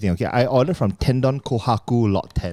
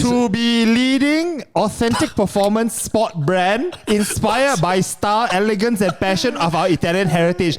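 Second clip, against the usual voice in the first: pompous ad read; 6 semitones higher than usual. Pretend. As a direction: read it like a lofty brand motto. Reads shoe box motto mockingly.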